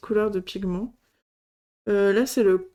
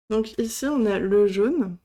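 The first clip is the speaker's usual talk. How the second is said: ordinary delivery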